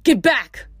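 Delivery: urgent warning. A narrator's reading. Frank warns campers of basilisks, urgent. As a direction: warn them off urgently. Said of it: "Frank warned".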